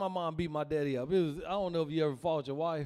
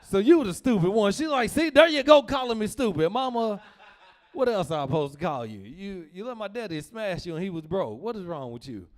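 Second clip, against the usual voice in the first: heated argument; 2 semitones higher, 8 dB louder than usual. Retold or imitated. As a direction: argue back loudly. Arguing with mom.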